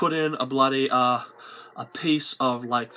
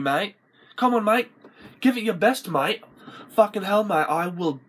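high, aggressive bogan challenge; acted fight challenge; 7 semitones higher than usual. Challenging someone over his mum, high-pitched mock aggression. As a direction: pick a fight, pitched right up.

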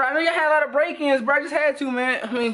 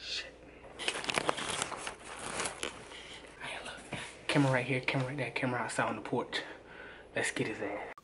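hushed whisper while hiding; 11 semitones lower, 14 dB quieter than usual. Real, not acted. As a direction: keep it barely audible, hiding. Hiding from the victim.

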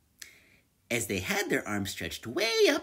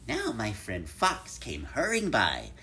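usual speaking voice

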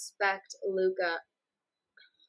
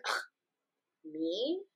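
mock dismissive scoff; 7 semitones lower, 8 dB quieter than usual. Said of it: "And he's like".

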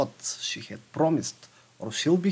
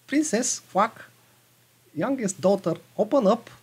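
high, loud frog call with a quack; 6 semitones higher, 3 dB louder than usual. Acted. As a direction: demand it like a pushy little frog. Frog knocks at door.

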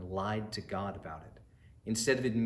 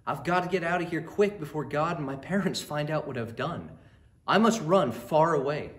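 panicked, stammering and rising to a loud cry; terrified; 5 semitones higher, 5 dB louder than usual. Acted. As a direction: blurt it out in a panic, higher and louder. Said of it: "He was terrified and said".

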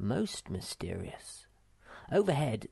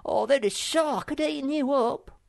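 high, bossy little creature giving orders; bossy little Sniff; 14 semitones higher, 7 dB louder than usual. Acted. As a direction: play the self-important path pioneer, pitched high. Sniff voiced in story.

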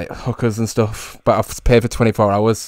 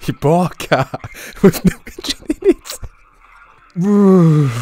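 slow mock repeat through laughter, higher and louder; imitating, laughing; 7 semitones higher, 4 dB louder, much slower than usual. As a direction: laugh while mimicking him. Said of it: "The way he repeated it".

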